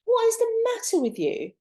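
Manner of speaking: high, incredulous What is wrong with you; exasperated disbelief. Retold or imitated. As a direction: throw up your hands at the conspiracy theorists. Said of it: "And I'm like".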